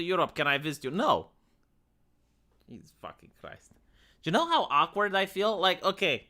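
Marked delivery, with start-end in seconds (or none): 2.7-3.7 whispered curse. Real, not acted. Muttered aside after fan question.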